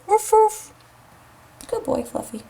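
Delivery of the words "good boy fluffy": much softer, low praise for a sleeping dog; hushed praise. Real, not acted. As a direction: barely breathe it to the dog. Dog napping.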